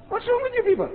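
high, incredulous exclamation; exasperated cry. Retold or imitated. The speaker to his friend Sali, incredulous and high. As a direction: throw up your hands at them.

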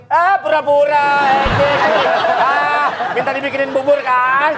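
high, slow, mocking nag; teasing wife. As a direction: scoff like a skeptical wife. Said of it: "disangka acting nggak sama Mbak Widi".

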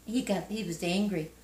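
ordinary talk, nothing marked

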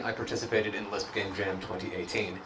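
ordinary delivery

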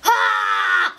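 high, loud shout of attack; battle shout. Real, not acted. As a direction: shout as you strike. Attacking a pig.

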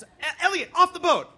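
stammer, then a high loud yell; panicked father barking an order; freaking-out dad. Retold or imitated. Dad ordering son overboard, panicked shout. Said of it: "my dad's freaking out".

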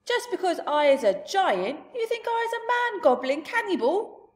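a giant's loud, laughing shout. Acted. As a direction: bellow it with laughter. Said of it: "he shouted".

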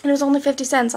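ordinary talk

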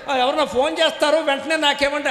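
mocking a devotee, laughing and high; distracted devotee. Preacher mocks a devotee who wants phone calls during puja, laughing. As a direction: lightly play the fussy worshipper.

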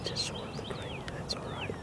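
hushed TV golf commentary; golf commentator whisper. Acted.

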